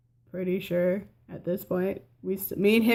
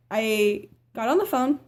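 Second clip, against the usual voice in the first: ordinary talk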